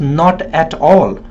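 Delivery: slow, separate words "not", "at", "all"; word-by-word "not at all" with gaps between; pronounced as written. Shown as a demonstration. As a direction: break "not at all" into three careful words. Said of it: "the word is written as".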